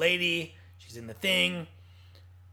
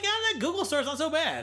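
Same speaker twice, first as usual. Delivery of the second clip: higher, laughing grudging admission; laughing mock concession; 8 semitones higher than usual. Host mocking his own change of heart, laughing.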